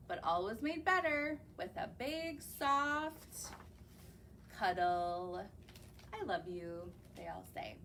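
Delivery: gentle, lilting storybook rhythm; reading to children. Acted. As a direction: read the rhyme to a little audience. Reads rhyming picture book aloud.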